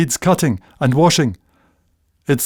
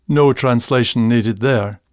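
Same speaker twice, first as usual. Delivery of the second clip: ordinary talk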